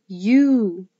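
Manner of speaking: U held long as "yoo"; long U sound. Shown as a demonstration. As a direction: draw U out into a long, clear "yoo". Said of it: "the long sound of the letter U".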